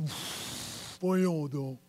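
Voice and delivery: puff, then a low, soft scoff; muttered disbelief. Retold or imitated. Preacher mimicking sceptics in the congregation, muttered disbelief. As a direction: imitate a doubter muttering under their breath. Imitates sceptical listeners' reaction.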